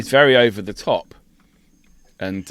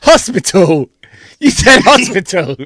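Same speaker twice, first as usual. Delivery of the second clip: laughing tease; 7 semitones higher, 7 dB louder than usual. Real, not acted. Teasing friend's slip.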